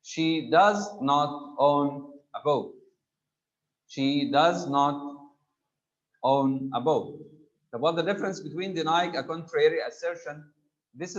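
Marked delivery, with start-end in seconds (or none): 0.0-2.7 slow, each word spaced, DOES and NOT held apart; slow negative. Shown as a demonstration. Teacher slowly modelling a negative sentence for the class. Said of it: "Making a sentence negative". 3.9-7.2 slow, each word spaced, DOES and NOT held apart; slow negative. Shown as a demonstration. Teacher slowly modelling a negative sentence for the class. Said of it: "Making a sentence negative".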